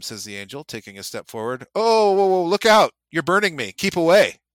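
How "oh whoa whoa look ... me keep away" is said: loud, high, alarmed yelp; panicked cry. Acted. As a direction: recoil from the burning angel. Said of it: "said the ghost".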